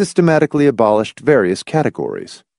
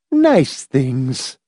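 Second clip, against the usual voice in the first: prim reproof, pitched a little up; reproving mother; 4 semitones higher than usual. A narrator's reading. Mother answering her complaining son, reproving. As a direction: correct a child firmly. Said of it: "his mother said reprovingly".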